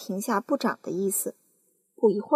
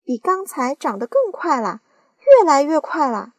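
louder and louder, high; aunt shouting as it grows faster; excited shout; 5 semitones higher, 10 dB louder than usual. Acted. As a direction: build to a loud cry. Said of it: "喊了起来".

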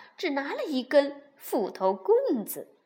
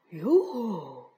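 pleased bear; 8 semitones lower than usual. A narrator's reading. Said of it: "大熊看上去十分高兴".

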